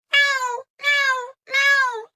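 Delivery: high, loud cat meows; cat meowing. A narrator's reading. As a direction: beg like a cat. Cat wants more food.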